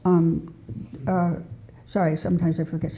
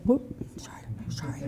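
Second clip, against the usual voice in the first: real whispered aside, much softer; whispered apology; 4 semitones lower, 11 dB quieter than usual. Real, not acted. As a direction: whisper a quick sorry under your breath. Slip aside during talk.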